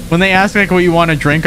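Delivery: usual speaking voice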